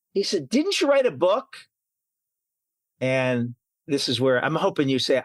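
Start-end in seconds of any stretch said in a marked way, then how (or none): 0.5-1.5 high-pitched curious question; intrigued recognition. Retold or imitated. Restaurant acquaintance asking the author, retold with high-pitched curiosity. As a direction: imitate someone suddenly placing who this is.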